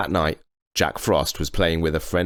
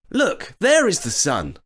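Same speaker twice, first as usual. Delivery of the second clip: put-on high boyish voice; boy's voice; 13 semitones higher than usual. A narrator's reading. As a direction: sound cheerful and clever as a boy. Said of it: "said Jack".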